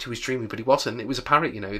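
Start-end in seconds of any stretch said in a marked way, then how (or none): none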